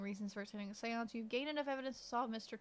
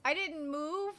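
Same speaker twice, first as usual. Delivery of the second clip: ordinary delivery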